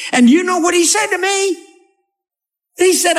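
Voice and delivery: high, incredulous tattling whine; mock indignant Ahab. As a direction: complain like a wronged child. Preacher voices Ahab complaining.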